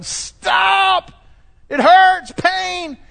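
high, loud pained yell; muscles screaming. Pretend. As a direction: shriek like aching muscles begging you to stop. Sore muscles cry out.

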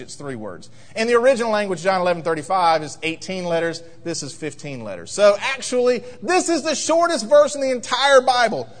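normal talking voice

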